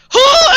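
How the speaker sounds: high, loud comic gasp-yell; big mock shriek of shock; hammed-up high cry on falling over; imitated startled scream. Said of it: "like, scream".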